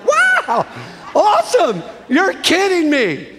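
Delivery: very high, delighted disbelief; over-the-top astonished exclamations; retold amazement. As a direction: act out being blown away, pitch leaping up. Retold amazement at being invited.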